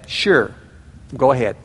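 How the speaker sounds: low-pitched mock sulky reply; passive-aggressive. Spouse asked about going to bed, passive-aggressive. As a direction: give in curtly, withholding. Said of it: "demonstrate passive-aggressive behavior".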